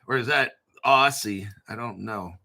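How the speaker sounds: ordinary talk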